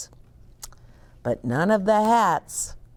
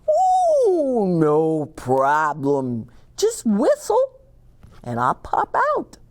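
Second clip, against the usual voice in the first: bear voice; 2 semitones higher than usual. Acted. Bear promises Milo to pop out. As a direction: toss it off as if nothing.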